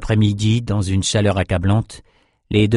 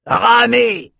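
a high, loud bark at the rowers; bellowed order; 13 semitones higher, 3 dB louder than usual. A narrator's reading. The oar master roars at the slaves, loud and high. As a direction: blast the command down into the hold. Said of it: "éructa Bosco".

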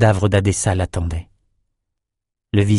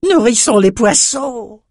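bawled; 13 semitones higher, slower than usual. A narrator's reading. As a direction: bellow it across the deck. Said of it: "brailla Crayeux".

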